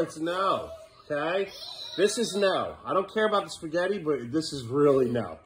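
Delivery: ordinary talk, nothing marked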